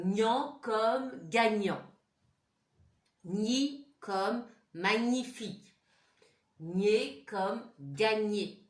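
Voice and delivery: slow corrected reading. Shown as a demonstration. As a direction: model the right word for pupils. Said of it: "ça ne va pas du tout ce que je viens de dire". (lecture lente et forte, bien articulée)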